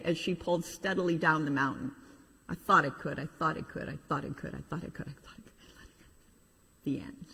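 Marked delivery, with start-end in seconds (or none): 2.5-6.8 soft chant fading to a whisper; fading away. Acted. Little engine rolling away downhill, chant fading to whisper. 6.8-7.3 soft whispered close; whispered ending. Acted. Preacher closing the children's story, whispered ending.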